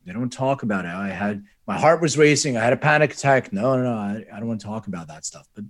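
usual speaking voice

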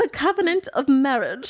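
hammed-up churchy grandeur; mock-pious answer. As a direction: draw it out like a sanctimonious reply. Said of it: "don't be all like".

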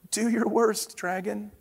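timid knight daring the dragon; small squeaky bravado, tailing off; squeaky little knight. Acted. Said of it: "squeaked a thin, shiny knight".

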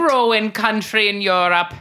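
a bad Irish accent telling a joke; terrible Irish accent. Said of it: "speaking in this terrible Irish accent".

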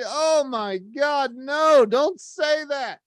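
high, drawn-out mock despair; dismayed pleading. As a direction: plead in horror, stretching it out slowly. Retold dismay at bad news.

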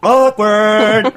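loud, high, drawn-out teasing call; mock awkward. Host teases co-host about the embarrassing moment, mock awkward. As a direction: sing out the word, hamming it up. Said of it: "It's still awkward".

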